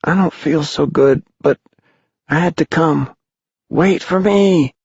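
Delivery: put-on high, weak child's voice, pleading; frail sick boy. A narrator's reading. Sick boy calls to his friends.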